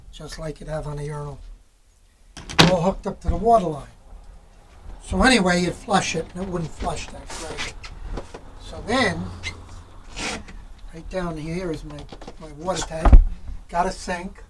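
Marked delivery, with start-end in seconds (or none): none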